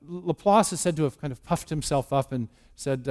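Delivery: ordinary delivery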